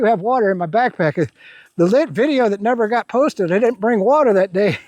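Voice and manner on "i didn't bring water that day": usual speaking voice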